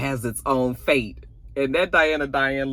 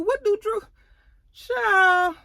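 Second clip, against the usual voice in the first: incredulous squeal; 3 dB louder, slower than usual. Real, not acted. Recapper reacting to Drew taking charge, high disbelieving squeal. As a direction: shriek it in disbelief.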